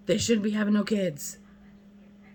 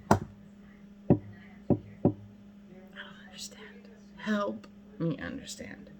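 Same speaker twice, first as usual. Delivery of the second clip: breathy pleading whisper; 4 dB quieter than usual. Real, not acted. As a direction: whisper it as a real plea. Closing plea, whispered.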